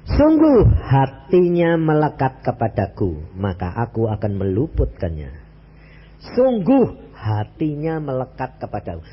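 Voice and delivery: slow, weighty reading of the verse; solemn scripture reading. Acted. Preacher reading the psalm to the congregation, slow and solemn. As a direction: let each word of the verse land slowly.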